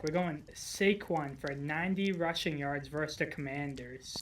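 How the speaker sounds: ordinary talk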